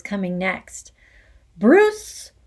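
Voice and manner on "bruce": loud, slow barked name. Acted. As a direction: bark the name across the hall. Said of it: "the Trunchbull barked suddenly".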